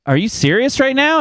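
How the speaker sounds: mock-incredulous challenge to the wife; retold disbelief. Husband questions wife about the restaurant, incredulous.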